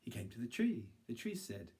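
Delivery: usual speaking voice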